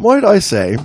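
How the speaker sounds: high mock British voice; British accent. Host echoing co-host's British diver, mock British accent. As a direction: echo it in a put-on British pitch. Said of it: "He, he's a Brit".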